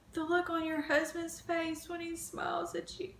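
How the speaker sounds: soft and tearful; emotional. Real, not acted. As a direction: near tears, barely holding it together. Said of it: "I'm getting too emotional".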